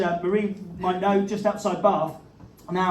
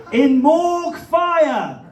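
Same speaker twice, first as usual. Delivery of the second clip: high, loud, very slow proclamation; dramatic headline read; 7 semitones higher, 7 dB louder, much slower than usual. Pretend. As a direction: hammer home the punchline slowly. Completes headline reading.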